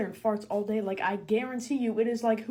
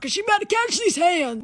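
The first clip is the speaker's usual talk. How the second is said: loud, higher, sassy threat; threatening to fight the grandma, loud sassy bravado; mock threat; 9 semitones higher, 8 dB louder than usual. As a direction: square up with attitude.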